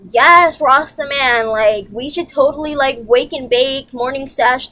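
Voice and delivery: loud, excited mock stoner pitch; mock stoner enthusiasm. Pretending to invite Shelby to smoke, mock loud excitement. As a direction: ham up a pal keen to smoke. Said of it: "or if I was like".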